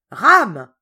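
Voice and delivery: very loud summoning shout; thundering call. Acted. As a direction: bellow his name. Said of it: "appela-t-il d'une voix formidable".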